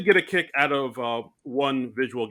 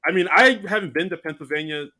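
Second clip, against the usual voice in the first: ordinary delivery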